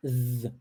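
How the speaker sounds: buzzing z for the voiced th. Shown as a demonstration. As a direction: vibrate the tongue between the teeth. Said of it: "Mais uma vez".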